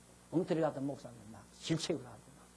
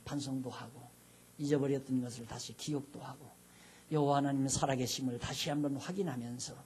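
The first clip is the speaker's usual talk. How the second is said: prayer; 4 semitones lower than usual. Acted. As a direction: pray humbly over the congregation. Closing prayer. (회중을 위한 낮고 차분한 마무리 기도)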